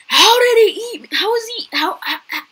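high, loud disbelieving shout; outraged yell. Real, not acted. Gamer yelling after getting eaten.

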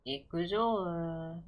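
slow, stretched battle cry; drawn-out battle cry. Pretend. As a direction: stretch it out like an anime hero. Game-start battle cry.

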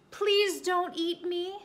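high, slow, pleading plea; pleading bunny. Acted. Rabbit begging the hiding bear, high slow plea. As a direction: beg the bear in a small high voice.

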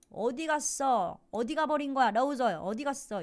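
mock indignant neighbour; demanding neighbour. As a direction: push the question like an upset neighbour. Teacher acts neighbour asking.

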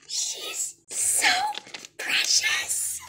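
very high, breathless whisper; awed squeaky whisper. Real, not acted. Vlogger seeing the doll, whispering in awe.

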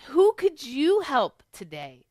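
ordinary delivery, nothing marked

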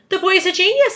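high, loud exclamation; announcing. A narrator's reading. Centipede praising James's plan, announcing. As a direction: proclaim it to everyone. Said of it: "the centipede announced".

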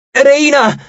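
high urgent call, falling; yelling. A narrator's reading. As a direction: shout her name across the battle. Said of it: "urlò Nico".

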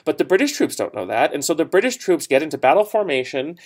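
usual speaking voice

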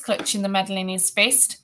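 ordinary delivery

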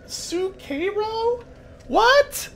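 very high, baffled disbelief; incredulous questioning. Real, not acted. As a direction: question it slowly, then squeak in disbelief. Baffled by a pronunciation.

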